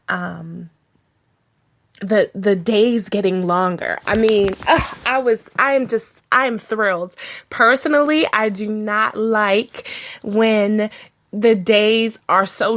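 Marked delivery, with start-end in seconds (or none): none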